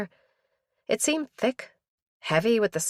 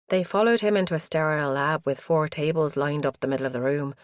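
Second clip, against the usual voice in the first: ordinary delivery, nothing marked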